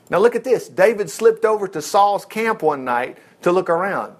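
ordinary delivery

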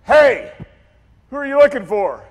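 high, loud hail to a crowd; yelled challenge. Retold or imitated. Jesus to the approaching crowd, yelling. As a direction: yell it out across a distance. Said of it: "then he yells at them".

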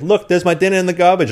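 loud, high mock Irish protest; Irish accent. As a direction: imitate an upset Irish roommate complaining. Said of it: "work on your Irish accent".

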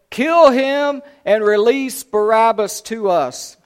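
loud, slow, high-pitched cry; crowd's roar. A narrator's reading. Crowd answering Pilate, roaring. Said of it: "a mighty roar rose from the crowd".

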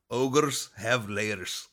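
slow, drawn-out Scottish-tinged ogre; lightly voiced Shrek, very slow; a hint of a Scottish ogre accent, drawn out. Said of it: "I'm sorry for my accent ... trying to sound like Shrek".

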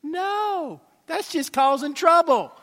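high, loud emphatic no; preacher answering his own question, emphatic. Real, not acted. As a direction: shut the idea down hard.

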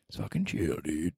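unvoiced whisper to himself; whispered calm-down. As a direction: breathe it out, no voice. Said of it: "repeats, now whispered".

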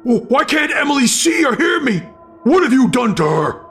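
high, stammering angry demand; desperate, high-pitched accusing cry; frantic confrontation. Acted. Hero frantically confronts his double.

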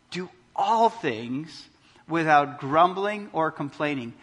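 very slow "Do all things" with "all" lifted; stress on all. Shown as a demonstration. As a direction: savour "all" like underlining it. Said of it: "Underline all things".

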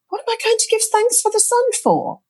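you can't believe what you're hearing; high-pitched mock disbelief; incredulous. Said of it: "thinking, "This is just bonkers".

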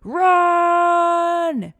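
long, high, loud yell; urgent drawn-out shout to flee; shouted warning. A narrator's reading. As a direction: bellow the alarm and hold the vowel.

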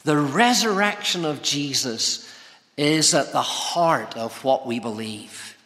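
normal talking voice

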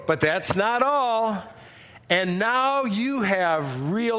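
showman's drawn-out prize reveals; big, hyped host delivery with stretched words; game-show announcer voice. Acted. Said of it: "in my best Jay voice".

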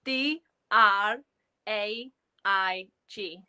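slow, letter by letter; spelling out. Shown as a demonstration. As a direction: spell it out with space between the letters. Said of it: "So it's spelled".

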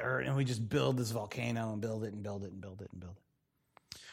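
soft, breathy building whisper; hushed whisper piling up the words. Acted. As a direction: murmur it like a confession.

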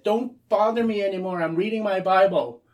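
put-on annoyed teenager telling his mother off; irritated teen. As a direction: brush her off, fed up.

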